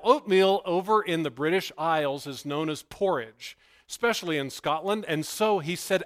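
normal talking voice

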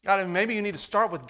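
ordinary talk, nothing marked

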